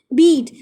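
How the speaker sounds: बीट repeated with a long "ee"; shown pronunciation. Shown as a demonstration. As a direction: answer with a clear long-vowelled बीट. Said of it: "क्या पढ़ते हैं?". (बीट को लंबी "ई" से दोहराएँ)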